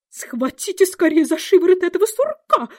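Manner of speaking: high, piercing royal shriek; shrill scream. A narrator's reading. Queen screaming at the guards to seize the Dormouse, piercing and high. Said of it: "пронзительно закричала королева".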